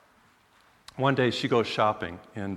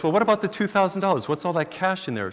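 eager husband; 7 semitones higher, 3 dB louder than usual. Retold or imitated. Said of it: "then he goes".

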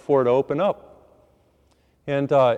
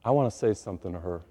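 quiet inner thought; 6 semitones lower, 7 dB quieter, faster than usual. Retold or imitated.

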